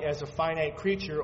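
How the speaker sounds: ordinary talk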